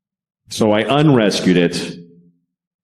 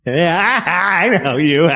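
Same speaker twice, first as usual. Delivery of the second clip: higher and very slow, dopey dog; dog's voice; 7 semitones higher, much slower than usual. Retold or imitated. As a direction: voice the clueless dog in the middle of the family drama, high and slow. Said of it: "the dog's sitting there like".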